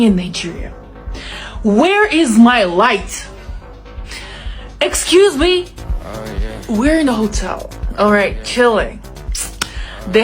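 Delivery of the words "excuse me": ordinary talk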